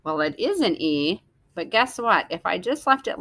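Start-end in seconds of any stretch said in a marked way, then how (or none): none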